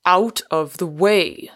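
contained burst of fury; sudden fury. A narrator's reading. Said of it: "with a sudden fury".

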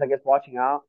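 ordinary delivery

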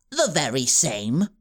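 low, smug menace; scheming villain. A narrator's reading. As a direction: purr the threat down at them. Wilfred confirms coolly.

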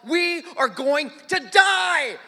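slow, high doom-laden shout; drawn-out mock-terrified cry; panicked yelling. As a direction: bellow it word by word in hammed-up panic. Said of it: "I am yelling, I'm freaking out".

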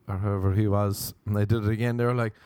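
ordinary delivery

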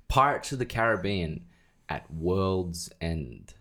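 slow, drawn-out title reveal; dramatic slow title. Acted. Title announced again, slowed.

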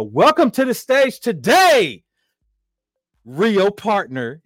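big loud stage welcome; announcer welcome. Real, not acted. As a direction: announce her like a show host. Host announcing guest on stage.

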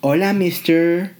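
high, slow, camp Spanish greeting. Shown as a demonstration. Camp mock-Spanish hello to a stranger. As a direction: sing out the hola like a camp tourist. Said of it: "so cum with it and camp".

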